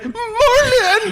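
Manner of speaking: high shout of a name. Pretend. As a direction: call the name out loud. Calling out his own name.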